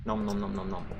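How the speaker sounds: high, quick cartoon munching; playful munching noises. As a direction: pretend to gobble a cookie. Pretends to eat the cookie.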